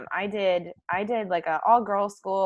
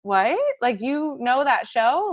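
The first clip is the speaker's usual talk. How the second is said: re-enacted disbelief, higher and louder; astonished reaction; 7 semitones higher, 6 dB louder, slower than usual. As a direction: act out amazed disbelief, rising at the end. Acting out her astonished reply to friend.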